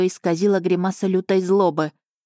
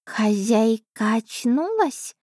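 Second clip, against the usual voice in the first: higher, slow small cat voice; little cat voice; 5 semitones higher, much slower than usual. Acted. The cat Asha checking if her mistress is awake, small and high. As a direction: ask like a small anxious cat. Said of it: "голосок фамильяра".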